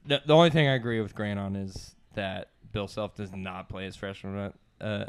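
usual speaking voice

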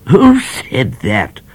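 a red-faced judge demanding. Acted. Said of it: "demanded the judge".

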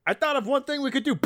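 imitated perfectly timed line. Man in the chair offering an idea, high quick imitation. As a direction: blurt it high and fast, mimicking him. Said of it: "The inflection in his voice is perfect".

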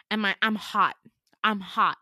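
loud heated anger; fired up, angry. Real, not acted. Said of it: "I'm angry".

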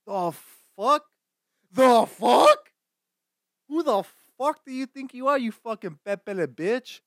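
mock outrage. Bartender berating pretentious French-sounding customer, mock outrage. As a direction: blow up in comic disbelief.

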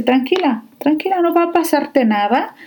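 calm her down like a kind doctor; reassuring doctor. Retold or imitated. Doctor calming crying patient. (doctora tranquilizando a la paciente que llora, voz suave y más aguda)

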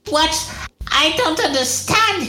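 very high cartoonish anime girl voice; rejected anime character. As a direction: squeak it out like an anime heroine. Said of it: "I don't know why she sounds like a rejected anime character".

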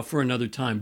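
usual speaking voice

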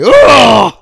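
loud, high "ugh" of revulsion; mock disgusted scream. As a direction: scream out as if horrified by an ugly sight. Imitates classmate's reaction on filming him.

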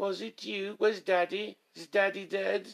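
high, questioning mock English child; English accent. Child asking after Daddy, in a put-on English accent. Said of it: "I was doing my English accent".

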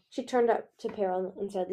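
ordinary delivery

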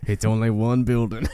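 slow, low cowboy drawl; cowboy accent. Acted. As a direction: draw it out like a movie cowboy. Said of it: "Cowboy accent can be cool".

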